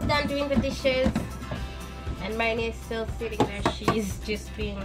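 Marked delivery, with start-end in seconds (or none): none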